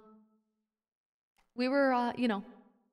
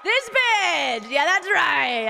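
high, loud, drawn-out yell; shouting; 10 semitones higher, 4 dB louder, much slower than usual. Real, not acted. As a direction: scream the reveal. Shouted yeast infection reveal.

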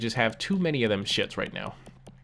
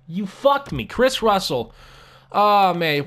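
higher, exasperated complaint; frustrated rant; 5 semitones higher, 5 dB louder, slower than usual. Real, not acted. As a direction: keep venting at the bad contract. Continues the rant.

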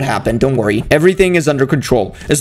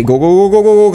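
louder rapid-fire repeated urging; frantic urging; 4 semitones higher, 4 dB louder than usual. Pretend. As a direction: spur the crew on in a playful panic. Frantically urging game workers on.

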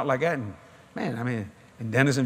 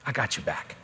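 lower, softer voice of God; a gentle, softer God promising support; God reassuring; 6 semitones lower, 7 dB quieter than usual. Retold or imitated. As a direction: reassure him quietly, as God. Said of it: "He said".

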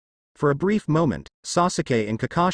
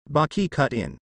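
ordinary delivery, nothing marked

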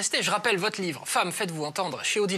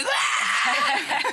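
laugh; 6 semitones higher than usual. Real, not acted. Laugh at angry parenting.

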